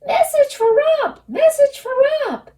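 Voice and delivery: very high, loud gnome squeal. Acted. Gnome running up to Rump, high loud squeal. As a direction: shriek it like an excited little gnome. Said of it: "a gnome scurried up the road squealing".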